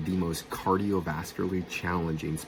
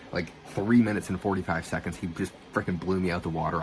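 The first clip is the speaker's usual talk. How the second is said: ordinary talk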